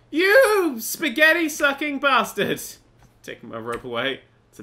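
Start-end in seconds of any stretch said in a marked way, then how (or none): 0.1-2.6 loud, high, drawn-out insult; angry yell. Real, not acted. Player cursing the rope thief, drawn-out angry yell. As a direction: stretch the insult out as you shout.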